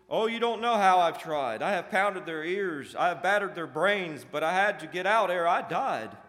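loud, high, hammed-up complaint; exasperated protest. A narrator's reading. Jealousy defending failed efforts to the prince, exasperated. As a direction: plead your case emphatically to a boss.

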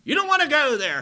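high, loud preaching warning; mock sermon warning. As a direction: warn the boys like a fiery preacher. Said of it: "He's all but preaching a sermon".

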